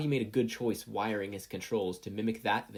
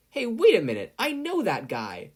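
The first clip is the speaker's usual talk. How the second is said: much higher and louder, startled; sudden recognition; 12 semitones higher, 8 dB louder than usual. A narrator's reading. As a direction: blurt out the realization. Sudden realization on seeing him.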